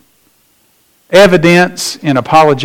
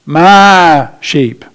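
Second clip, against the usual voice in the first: "My" punched, "sheep" falling away; emphatic; 3 dB louder than usual. Shown as a demonstration. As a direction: stress on My in "My sheep". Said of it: "Emphatic again".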